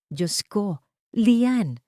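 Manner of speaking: a hammed-up gasp of concern; mock dismayed exclamation. A narrator's reading. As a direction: gasp out her name as if shocked. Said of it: "bulalas ni Margot".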